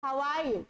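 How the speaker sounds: relaxed spoken "are" with the r dropped. Shown as a demonstration. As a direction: glide "How are you" with "are" as "ah". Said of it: "आर का pronunciation गायब कर देना".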